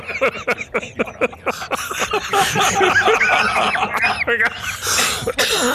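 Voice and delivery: high long burst of laughter. Real, not acted. Reporter laughing at his own blunder. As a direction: crack up at your own gaffe.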